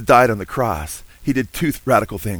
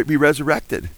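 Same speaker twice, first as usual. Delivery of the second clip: usual speaking voice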